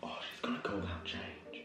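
soft hushed whisper; whispering while hiding. Real, not acted. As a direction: whisper while the seeker walks past. Hiding, seeker nearby.

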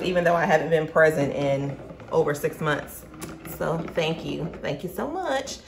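normal talking voice

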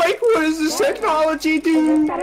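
very high changed voice; squeaky voice filter; morphing voice. Real, not acted. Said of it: "is my voice changer on".

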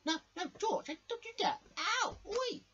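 very high, loud cartoon protest; panicked protest. Crash protests being thrown away, high panicked squeal.

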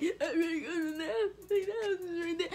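high tearful wail about the wheels; crying. Retold or imitated. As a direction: sob it out to mom. Crying to mom after tire.

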